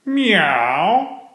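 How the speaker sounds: high mewing cat sound; little kitten meow. Acted. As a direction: mew like a tiny kitten hiding. Kitten meows from the plant.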